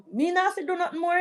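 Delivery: quick, high, pleased rush; a breezy brag; gleeful boast. Real, not acted. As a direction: rattle it off, tickled. Boasting about her household.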